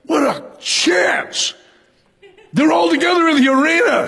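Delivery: shouted high with excitement; thrilled at the chance, calling out; eager Paul. Retold or imitated. Preacher voicing Paul thrilled by the riot, high excited shout. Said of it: "He saw that riot as like".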